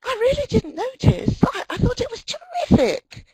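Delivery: very high, loud mock gushing; hammed-up gushing reassurance. American forced to keep praising a nervous presenter, exaggerated gushing. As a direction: overdo the enthusiastic praise, pitched right up. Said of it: "then they're going to have to say".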